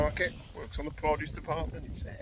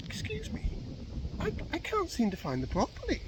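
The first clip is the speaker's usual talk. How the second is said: high-pitched, hesitant customer voice; mock-polite high voice; customer asking politely. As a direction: put on a higher voice for a puzzled shopper. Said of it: "she comes on the other way and she's like".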